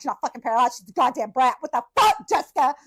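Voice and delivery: loud, high exasperated scolding; angry mother yelling. Retold or imitated. Mother scolding her daughter in front of the staff, angry yelling. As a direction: chew out the bratty kid.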